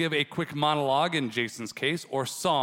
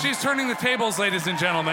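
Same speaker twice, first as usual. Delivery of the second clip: excited announcement; 3 semitones higher, faster than usual. Real, not acted. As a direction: hype it up for the crowd.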